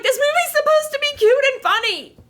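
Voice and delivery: high whiny protest at the screen; mock outrage. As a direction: complain like a betrayed fan. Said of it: "I'm like".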